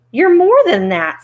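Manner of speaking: high, loud, grieved protest. Acted. Scarecrow accuses the little man, grieved. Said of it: "in a grieved tone".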